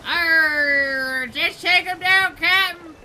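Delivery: loud hammed-up pirate yells. Player shouting to his captain in hammed-up pirate talk. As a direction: growl it out like a movie pirate. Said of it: "Why am I talking like that?".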